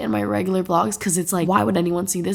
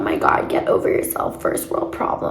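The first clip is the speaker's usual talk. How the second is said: mocking commenter. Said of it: "get the comment where it's like".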